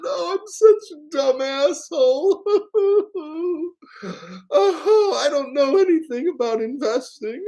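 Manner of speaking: high, loud, blubbering sob through the words; mock crying Jim Cramer. Mocking the crying host for his viewers, high wailing sobs. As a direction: ham up a weeping TV host, half laughing. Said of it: "after crying on live television".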